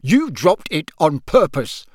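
a father bellowing at his sons; roared. A narrator's reading. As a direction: roar the accusation at him. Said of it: "roared Mister Weasley".

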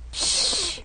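hissing snorkel breath; Darth Vader breathing. Acted. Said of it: "breathing sound just like Darth Vader's".

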